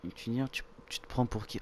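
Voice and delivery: usual speaking voice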